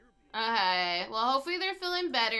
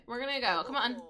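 ordinary talk, nothing marked

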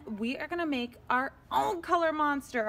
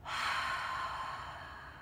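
long slow sigh; demonstrating a calming breath; deep breath out; 6 dB quieter than usual. Shown as a demonstration. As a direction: breathe out audibly and slowly. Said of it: "Let's all take a breath".

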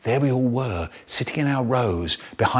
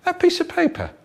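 shocked; faster than usual. Acted. Shocked high line.

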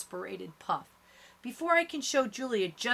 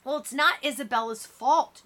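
high, louder defensive retort; yelling; 5 semitones higher, 5 dB louder than usual. A narrator's reading. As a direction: yell it back, slightly contained. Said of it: "Julia yells".

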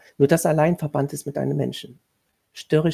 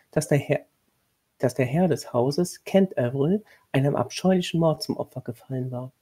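usual speaking voice

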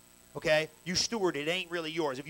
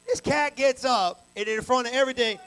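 loud buildup; 7 semitones higher, 9 dB louder than usual. Retold or imitated. Retelling preacher rising dramatically.

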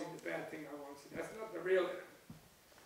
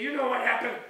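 loud, raised-pitch mock venting; retold angry outburst; 4 semitones higher, 10 dB louder than usual. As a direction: burst out as if fed up, retelling it. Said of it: "where I'm angry".

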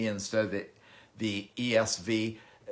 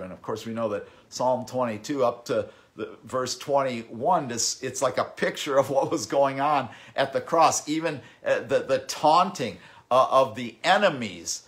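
normal talking voice

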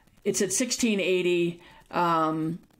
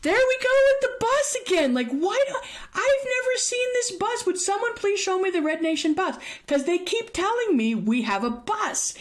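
exasperated rant; 13 semitones higher than usual. Retold or imitated. Answering the women's bus claim with high exasperation. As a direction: let it burst out in disbelief.

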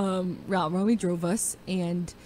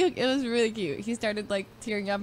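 ordinary talk

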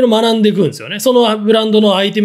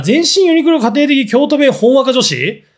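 slow, rising, higher disbelieving echo; annoyed disbelief, slowly; incredulous repeat; 6 semitones higher than usual. Real, not acted. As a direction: repeat it back like you can't believe it. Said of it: "なんかめんどくせえな".

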